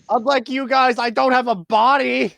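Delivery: high, loud comic complaint; indignant character. Acted. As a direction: protest shrilly to the whole pile. Mage Hand protests under the pile.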